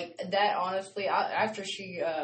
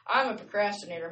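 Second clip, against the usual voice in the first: ordinary talk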